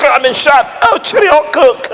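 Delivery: high, jaunty, cheery farewell; a British soldier bidding goodbye as he falls; mock British gentleman. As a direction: die with stiff-upper-lip cheer. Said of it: "You go".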